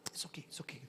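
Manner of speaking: very soft, breath-only comfort; consoling whisper. Retold or imitated. Pastor hugs the man who has repented, hushed consoling whisper. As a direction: soothe him in a whisper.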